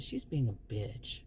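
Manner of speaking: hushed slow confidential aside; whispered aside. Retold or imitated. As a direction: lean in and murmur it to a friend. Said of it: "I whispered to him".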